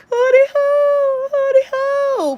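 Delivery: high, slow, sobbing hootie hoo; hammed-up tearful call; boo-hoo crying. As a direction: wail it like a lost little girl. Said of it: "boo-hoo crying".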